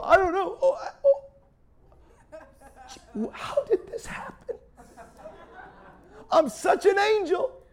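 très haute petite voix de faux innocent; hammed-up high-pitched "who, me?" act; mock innocence. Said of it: "Some of us just go".

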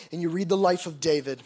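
ordinary talk

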